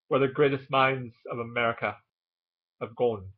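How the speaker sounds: upper-crust Boston Brahmin accent, R's dropped; English-sounding Boston accent. Shown as a demonstration. Vlogger attempting an English-sounding Boston dialect, Brahmin Boston accent. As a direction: put on an Anglicised old Boston accent. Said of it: "very English sounding".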